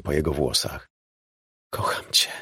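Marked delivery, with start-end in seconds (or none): 1.7-2.3 soft, voiceless whisper; loving whisper. A narrator's reading. Woman confesses love to her lover, whispered. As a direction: whisper it tenderly, all breath. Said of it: "szepnęła".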